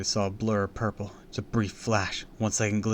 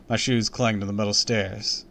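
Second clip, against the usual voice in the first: plain reading voice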